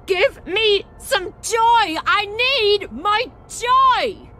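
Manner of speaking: desperate craving shout. Acted. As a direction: wail it out like an addict begging. Begging for joy pills.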